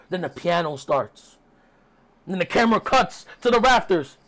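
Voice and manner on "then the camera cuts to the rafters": ordinary talk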